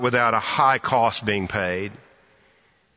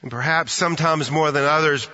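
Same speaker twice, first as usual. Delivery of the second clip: normal talking voice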